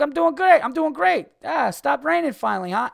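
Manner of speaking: very high, loud mock enthusiasm; hammed-up cheery chatter; overeager reply. Acting out overeager reply to lady.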